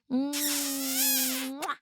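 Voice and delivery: smack a kiss at the mic; air kiss. Real, not acted. Thanking a viewer, blowing a kiss.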